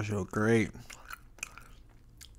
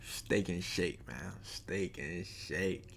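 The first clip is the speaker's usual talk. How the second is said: slow drawl; 3 semitones lower, 5 dB quieter, much slower than usual. Real, not acted. Drawn out name, ASMR ending.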